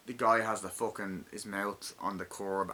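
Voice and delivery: ordinary talk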